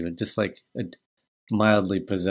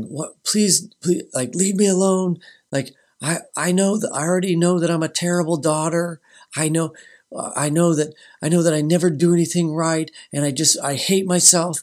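put-on higher, pleading, half-sobbing voice as the girl; imitating tearful daughter; 7 semitones higher, 3 dB louder than usual. Daughter begging her parents to leave her alone, imitated tearful plea. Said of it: "she's, like, in tears and screaming and crying".

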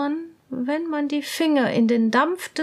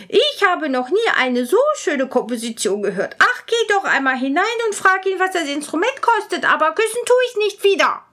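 higher, fast, eager princess; 6 semitones higher, 4 dB louder, faster than usual. Acted. Princess sends her lady again, high and quick. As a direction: rush the order, then refuse firmly. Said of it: "sagte die Prinzessin".

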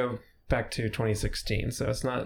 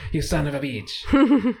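slow, loud, higher mock fury; mock angry curse; 6 semitones higher, 6 dB louder, slower than usual. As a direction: drag out the curse in fake outrage. Mock cursing the kayak guy.

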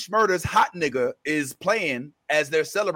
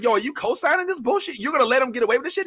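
indignant challenge; 7 semitones higher, much faster than usual. Retold or imitated. Will challenging Carlton's silence.

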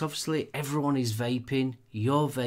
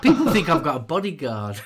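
laughing; 8 semitones higher, 8 dB louder than usual. Real, not acted. Joking about a big co-host.

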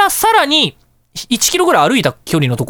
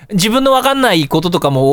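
usual speaking voice

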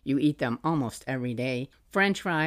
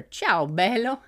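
slow, lightly voiced little boy saying Ciao, bello; toddler repeating Italian; 7 semitones higher, 4 dB louder, slower than usual. Mom imitating her son copying Rita's Italian greeting, lightly childlike. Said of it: "you'd repeat it back to her".

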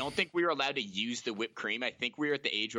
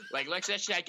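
usual speaking voice

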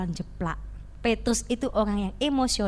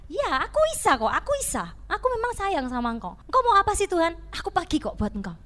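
higher, louder, cocky insistence; stubborn Peter; 6 semitones higher, 3 dB louder than usual. Acted. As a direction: brush off Jesus like a brash fisherman. Peter's stubborn reply voiced.

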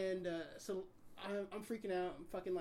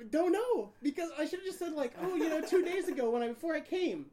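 high, loud, laughing frustration; exasperated; 10 semitones higher, 9 dB louder than usual. Real, not acted. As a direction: kick yourself out loud. Exasperated at own answer.

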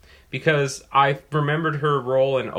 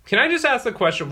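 usual speaking voice

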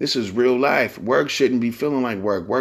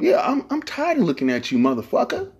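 high mock coworker; 10 semitones higher than usual.

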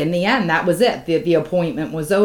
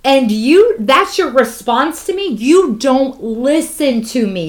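acted outburst, pitched up and punched; retold fed-up patient's rising protest; angry confrontation; 4 semitones higher, 4 dB louder, slower than usual. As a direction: confront them, hammering out each accusation.

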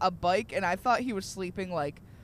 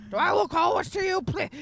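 fast, higher, laughing; mocking imitation; 10 semitones higher, 5 dB louder than usual. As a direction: parody the angry guy. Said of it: "laughing retell".

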